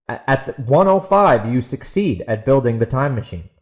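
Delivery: ordinary delivery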